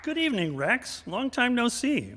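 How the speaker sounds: ordinary delivery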